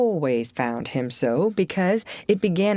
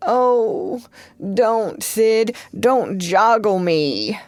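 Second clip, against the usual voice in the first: hammed-up moan, slow at the end; 4 semitones higher, much slower than usual. Tom faking illness to his brother, moaning. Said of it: "Tom moaned out".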